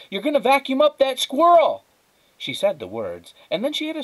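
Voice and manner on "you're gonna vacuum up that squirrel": much higher, loud alarmed cry; shouting. A narrator's reading. Flora shouting at the neighbour to spare the squirrel. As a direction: yell to save the squirrel. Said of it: "she shouted".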